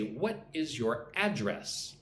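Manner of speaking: slow question with "address" stressed on the first syllable; slowly, hitting the AD in "address"; noun stress. Shown as a demonstration. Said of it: "That's a noun".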